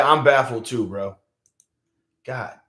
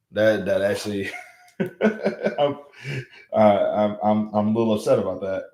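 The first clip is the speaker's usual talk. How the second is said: usual speaking voice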